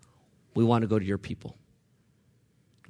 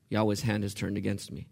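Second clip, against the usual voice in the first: "Yahweh's hand has turned against me" repeated softer and lower; 5 semitones lower, 8 dB quieter than usual. Shown as a demonstration. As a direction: say it again quietly, letting it sink in. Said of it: "There's theology there".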